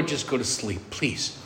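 low, very soft begging; weary hushed plea. Retold or imitated. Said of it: "I'd lay there in bed and go".

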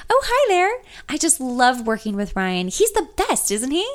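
bright, high, lightly twanged receptionist; sweet, chirpy Southern lilt; slight Southern twang. A narrator's reading. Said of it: "with the slightest twang".

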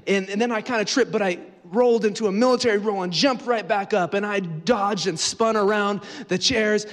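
high, loud, breathless-paced heroic boast; pumped-up bragging, pitched high; boastful action retelling. Acted. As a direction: recount your daring escape like an action hero. Acting out a boastful escape tale.

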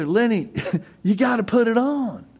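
high, laughing retort to a friend; Charlie laughing. Retold or imitated. Charlie laughing at Lenny over the paint. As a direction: laugh through the correction. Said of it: "And Charlie go".

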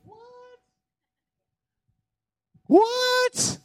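squeaky high amazed "What? What?"; Jesus stunned, voice leaping way up; high falsetto disbelief; astonished what. Retold or imitated. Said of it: "looks to his disciples and goes".

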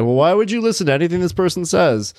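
high, scoffing imitation of know-it-alls; mocking dismissive quote. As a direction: mimic people brushing it off. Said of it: "people turn to you and be like".

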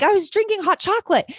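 high-pitched panicked denial, acted out; freaking out. Teacher denying beer to the principal, freaking out. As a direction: protest your innocence in a retold panic. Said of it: "And I'm freaking out".

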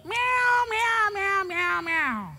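very high, squeaky mock kitten mewing; crying little cat. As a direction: mewl like a whiny little cat. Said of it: "Crying like a little cat".

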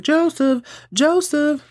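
high mock call for a missing puppy; calling out Joseph. Lance calling for the baby like a puppy, mock high call. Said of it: "because he's like".

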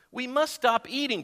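high, urgent storybook cry; mock-urgent exclamation. A narrator's reading. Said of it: "cried Toad as he ate another".

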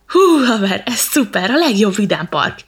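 loud, delighted whoop; monkey grinning ear to ear; thrilled. Acted. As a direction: shout for joy like on a ride. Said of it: "fülig érő szájjal".

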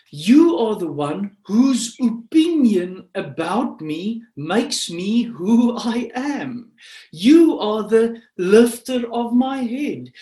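loud, high, impassioned proclamation; full-force preaching. Acted. David declares God his glory, full-force preaching. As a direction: cry it out with big stresses on each phrase.

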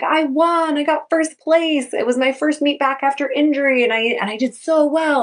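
high, thrilled imitation of winners; excited kids. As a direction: burst in with the good news. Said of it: "all these kids start filing in are like".

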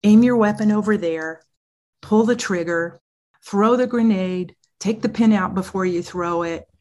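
mock drill-sergeant orders, loud and firm; commanding sergeant. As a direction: give crisp battlefield commands. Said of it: "you're gonna say".

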